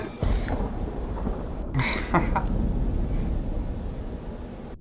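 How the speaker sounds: long airless laugh after winning; long silent laugh. Real, not acted. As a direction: let a soundless laugh run out after the win. Just won the game.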